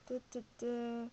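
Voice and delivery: normal talking voice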